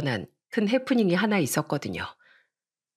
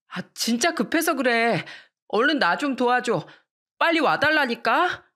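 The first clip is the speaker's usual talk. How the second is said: whiny, higher, rising insistence; urgent little brother; 6 semitones higher than usual. Acted. Brother begging sister to come, building higher and more insistent. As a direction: pester her, more urgent each line. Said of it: "남동생이 절 급하게 부르는 거예요".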